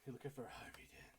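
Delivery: whispered, raspy superhero growl; voiceless, menacing Batman whisper; Batman impression. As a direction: hush it like a brooding caped vigilante. Mock Batman line in banter.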